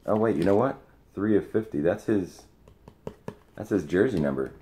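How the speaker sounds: suddenly light up as it clicks; excited realization. Real, not acted. Excited discovery of jersey number.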